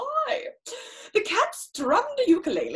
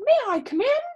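high little cat voice asking to come in; sweet cat begging entry; polite cat; 9 semitones higher, 4 dB louder, faster than usual. Acted.

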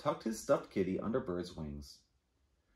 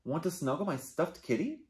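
gentle rabbit voice; 6 semitones higher than usual. Acted. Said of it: "asked Rabbit".

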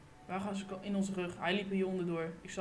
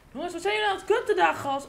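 fast, loud and higher, annoyed; exasperated shout; 7 semitones higher, 7 dB louder than usual. Real, not acted. Gamer yelling at his dying teammates, fast annoyed shout. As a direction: yell at the team for messing up. Yells at teammates after deaths.

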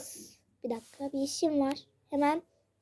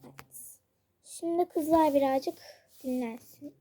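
usual speaking voice

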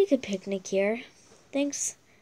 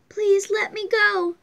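high, louder desperate begging; pleading; 9 semitones higher, 3 dB louder than usual. Acted. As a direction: beg to be let go. Captive begs the villain.

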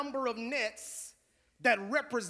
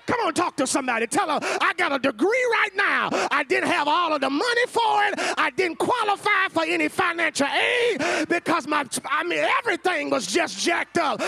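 loud, high, building sermon shout; preaching at full force; 6 semitones higher, 8 dB louder than usual. Acted. Preacher urging the church to nudge a neighbor, shouting.

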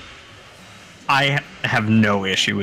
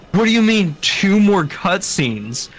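retold shock; 8 semitones higher, 3 dB louder, slower than usual. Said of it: "I was like".

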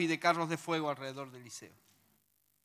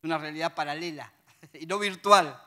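ordinary talk

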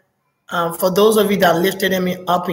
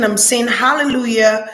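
normal talking voice

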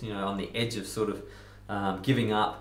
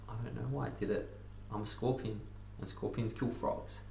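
resigned confession; 9 dB quieter than usual. Acted. Scorpion admits why he stung the frog, hushed confession. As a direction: say it softly, letting it fade.

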